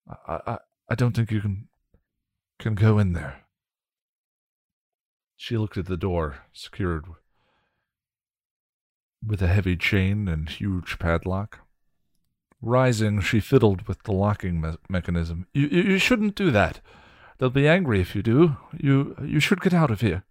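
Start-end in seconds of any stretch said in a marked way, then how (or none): 0.1-3.4 put-on timid young man. William's timid voice. 15.5-20.2 high, stammering, fearful pleading; plead, scared and high; frightened young man. Acted.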